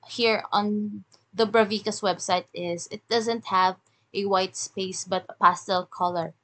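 normal talking voice

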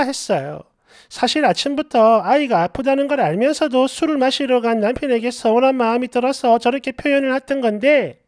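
usual speaking voice

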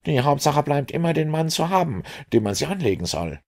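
a prickly policeman brushing him off; Lestrade. A narrator's reading. Lestrade voiced by narrator.

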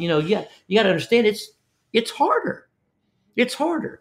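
put-on rapid high-pitched pep talk to a shy kid; excited encouragement. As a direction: pump the kid up, voice climbing high. Encouraging kid after fishing.

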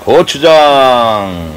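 slowly stretched echo; drawn out. Real, not acted. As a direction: draw the word out. Echoing the ingredient while adding.

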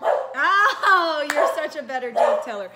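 big appreciative exclamation; delighted praise. Real, not acted. Praises child's punchline.

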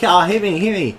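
high-pitched bully imitation; imitated jeering dare; goading taunt. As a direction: keep daring him, teasingly. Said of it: "like, mocking him".

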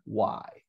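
"why" as wai, repeated; pronunciation model. Shown as a demonstration. Teacher repeating "why" for the class. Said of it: "este se pronuncia".

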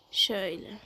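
ordinary talk, nothing marked

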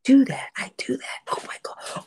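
hushed whisper to oneself, re-enacted; acting out a private whispered realization; quiet self-talk. Checking off her mental report card, whispering to herself. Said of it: "quietly to myself".

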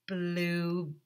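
ordinary delivery, nothing marked

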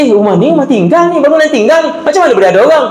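high-pitched, puzzled disbelief, retelling it; retold surprise. Speaker recalls spotting people in an abandoned building, retold surprise.

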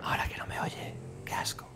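susurro casi inaudible, en falso secreto; susurro a escondidas. Pretend. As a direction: susurra como si el otro no te oyera. Finge que el otro no oye. (cuchicheo conspirador)

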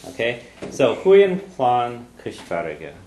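Huyen Plan Cushfarga said slowly and clearly, each syllable set out; teacher's Irish model. Shown as a demonstration. Teacher models the Irish for the learner, slow and clear. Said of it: "variant ways of saying it".

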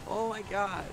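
high, through laughter; real giggly disbelief; laughing oh my god. Real, not acted. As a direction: gasp it out mid-laugh. Laughing after the trick.

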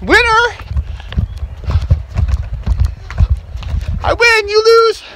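high, loud, laughing race up the stairs; excited racing. Real, not acted. Dad racing his kid up the stairs, excited and laughing. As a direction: shout with glee while charging up.

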